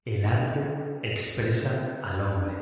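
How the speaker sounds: slow, lightly ghostly voice from the grave; a hint of a spooky voice from beyond; Suzuki from beyond the grave. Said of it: "Vamos a poner así voz de, de ultratumba, como si fuera Suzuki".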